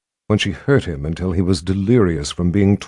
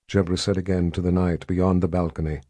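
usual speaking voice

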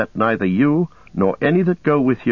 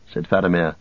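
plain reading voice

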